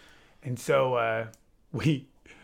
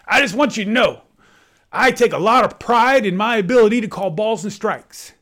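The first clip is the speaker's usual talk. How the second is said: higher, louder, sarcastic umpire lecturing the coach; mock sincere speech; 7 semitones higher, 7 dB louder than usual. Umpire mockingly addressing the coach, loud sarcasm. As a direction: play it earnest but mocking. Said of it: "he turns to the coach and says".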